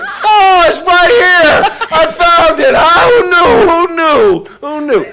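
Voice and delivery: high, loud, laughing bartender shout; overjoyed high-pitched bartender; Rodney Dangerfield. Retold or imitated. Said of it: "like Rodney Dangerfield".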